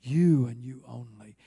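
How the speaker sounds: loud, stretched first "You", then falling away; slow declaration weighted on "You"; emphatic YOU. Shown as a demonstration. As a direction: hit "You" with full force. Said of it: "here's the way he would have answered it".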